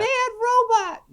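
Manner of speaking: very high, slow bad robot; squeaky high. Echoing a catchphrase.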